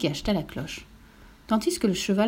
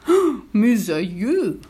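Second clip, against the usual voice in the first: long drawn-out, louder moo; lowing lament, vowels stretched out; mooing cow; 5 dB louder, much slower than usual. Acted. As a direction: moo the words slowly like a cow. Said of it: "mugit la vache".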